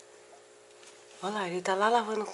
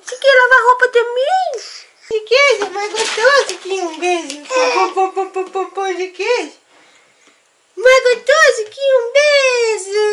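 much higher, louder playful sing-song; 10 semitones higher, 6 dB louder than usual. Real, not acted. Mother playing with her baby.

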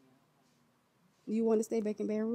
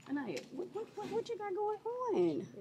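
sharp mock scold; 10 semitones higher, 3 dB quieter than usual. Parent scolding child prank.

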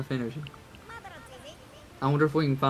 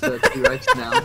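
much higher, slow squeaky remark; 8 dB louder, much slower than usual. Real, not acted. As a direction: squeak it out in a high voice. Reacts to game dinosaur.

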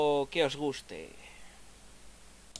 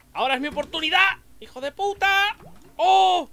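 much higher, loud, fast yell; raging gamer; 13 semitones higher, 9 dB louder than usual. Real, not acted. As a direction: scream at the game in rage. Attacks boss, fails, rages.

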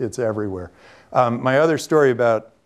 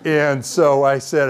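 usual speaking voice

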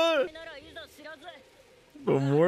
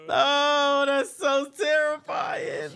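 excited shout; 7 semitones higher, slower than usual. Real, not acted. Reacting to tense anime scene.

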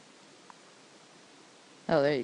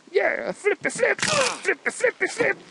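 high-pitched, giddy egging on; excited cheering; 10 semitones higher than usual. Real, not acted. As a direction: chant it high as the flips keep coming. Cheering on flips in game.

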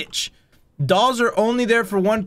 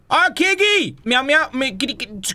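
calling a kitty; 7 semitones higher than usual. Real, not acted. Calling the cats.

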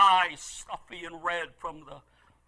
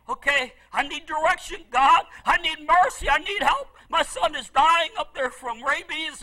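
urgent, louder and higher cry to God; desperate plea; 6 semitones higher, 8 dB louder than usual. Retold or imitated. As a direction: beg for help, voice climbing. Retold desperate prayer for son.

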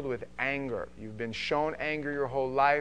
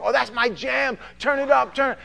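mock excited kid; 10 semitones higher, 7 dB louder than usual. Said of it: "they hear a song".

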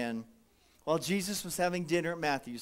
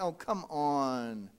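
low, slow, drawn-out "oh come on"; mock whine, low and slow; weary groan; 6 semitones lower, 5 dB quieter, much slower than usual. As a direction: sigh it out like you've had enough. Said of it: "and I'm like".

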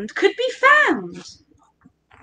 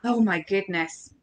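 ordinary delivery

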